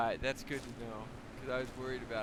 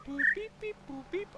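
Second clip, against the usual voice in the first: high, rising boops and beeps; imitated beeps; 4 dB louder than usual. Player echoing the puzzle's tones, imitated beeps. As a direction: mimic the beep pattern in a high pitch.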